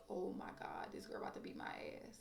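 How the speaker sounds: frightened inner thought, whispered; scared whisper. Retold or imitated. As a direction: whisper it in panic to yourself. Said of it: "I was so scared".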